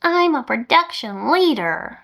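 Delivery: slow whiny nasal voice. Retold or imitated. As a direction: say it slowly through the nose. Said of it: "Just imagine the most nasally voice ever saying".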